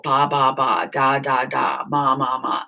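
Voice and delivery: lightly imitated baby babble; a mild imitation of baby babble; baby babbling. Shown as a demonstration. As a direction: run through ba, da, ma like a babbling infant. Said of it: "They babble".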